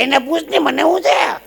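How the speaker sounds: high, loud testy retort; irritated old husband. Acted. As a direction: fire back at the doctor. Old man snaps at doctor.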